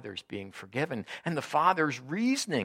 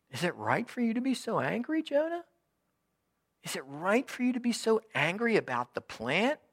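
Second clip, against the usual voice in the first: God's question lifted high and pleading; God asking Jonah again, lifted high and pleading; 10 semitones higher than usual. Acted. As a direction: raise the pitch and plead gently with him.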